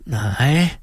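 ordinary delivery

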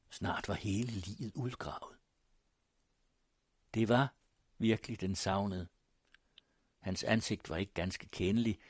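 ordinary delivery

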